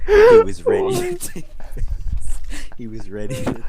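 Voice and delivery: reading through laughter; laughing through it. Real, not acted. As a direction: try to read while cracking up. Reader cracking up mid reading.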